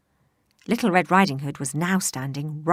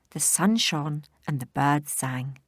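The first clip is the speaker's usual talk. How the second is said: plain reading voice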